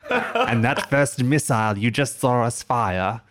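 slow, loud, high alien voice; alien villain voice. Acted. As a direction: declare it like a gloating alien villain. GM continues the villain's broadcast.

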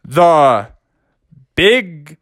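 drawn-out boxing announcer, rising; ring announcer. Acted. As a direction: introduce the fighter with big pauses. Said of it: "the announcer was like".

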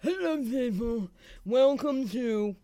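high, slow, stuffed-up greeting; stuffy sick voice. Retold or imitated. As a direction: sound like a server with a cold. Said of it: "somebody who's coming over to your table going".

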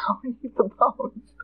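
laughs through the punchline; laughing at her own joke. Real, not acted. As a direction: giggle the warning out.

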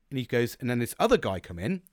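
usual speaking voice

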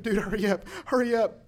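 urgent retold plea. Friend struggling with a hooked fish, imitated urgent plea. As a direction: imitate a buddy rushing you along. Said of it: "he's like".

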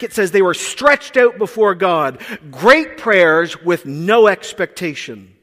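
loud, slow, driving sermon; full-force preaching. Acted. Preacher pressing the congregation with rising force. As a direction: hammer each phrase home.

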